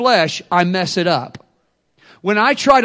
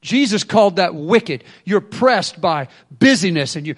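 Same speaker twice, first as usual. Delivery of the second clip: normal talking voice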